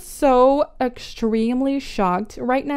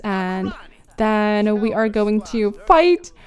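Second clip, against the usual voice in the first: ordinary talk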